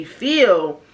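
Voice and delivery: ordinary talk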